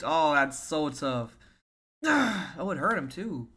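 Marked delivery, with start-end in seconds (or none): none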